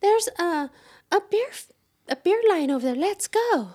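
very high put-on mocking voice; the talkative guy. Rival in the queue spotting a beer line, high mock voice. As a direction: imitate him in a high falsetto. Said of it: "the one guy who's doing all the talking goes".

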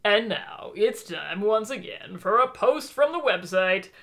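usual speaking voice

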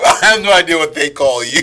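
laughing. Retold or imitated. Echoing S0 through laughter.

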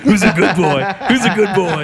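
put-on high, laughing good-boy praise; talking to a dog. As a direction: praise him like a happy puppy. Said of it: "you gotta talk to Mayday like he's one of them".

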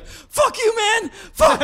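high, loud ecstatic yell at a concert; wild fan shouting at the stage; imitated yelling. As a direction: scream it like a fan overwhelmed at a show. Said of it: "He really started yelling".

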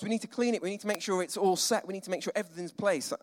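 ordinary talk

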